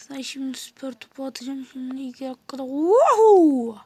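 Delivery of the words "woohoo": loud excited whoop; cheer. Real, not acted. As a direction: whoop it out with joy. Cheering in the game.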